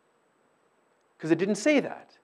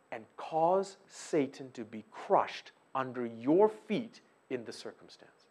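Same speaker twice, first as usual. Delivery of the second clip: normal talking voice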